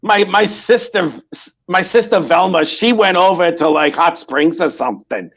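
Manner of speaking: dish the dirt like a nosy Bronx grandma; New York accent. Acted. Old New York lady trashing her neighbours, in a thick New York accent. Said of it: "all that New York just came out".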